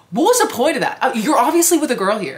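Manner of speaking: high, loud, baffled protest; exasperated disbelief. Retold or imitated. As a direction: protest it loudly, pitch jumping way up. Retells her baffled reaction to flirting.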